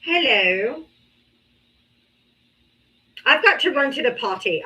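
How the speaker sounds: ordinary talk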